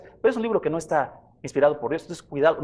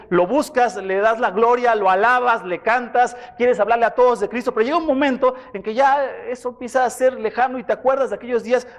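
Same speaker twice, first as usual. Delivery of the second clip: fervent preaching; 5 semitones higher than usual. Acted. Pastor urges his congregation with rising fervor.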